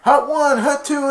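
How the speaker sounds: high, loud snap count; calling hut one, hut two up high; quarterback call. Retold or imitated. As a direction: bark the count at the line.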